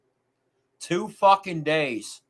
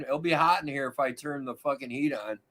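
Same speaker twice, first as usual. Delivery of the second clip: normal talking voice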